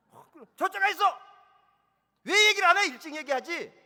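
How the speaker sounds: high loud exasperated scold; scolding shout. Retold or imitated. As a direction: yell at the kid, exasperated. Parent scolding. (아이를 높고 크게 꾸짖는 부모)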